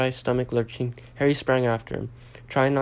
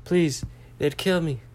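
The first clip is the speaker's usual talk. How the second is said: higher, worried plea; pleading; 5 semitones higher than usual. A narrator's reading. Harry begs the elf to stop, pleading. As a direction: beg with a touch of panic.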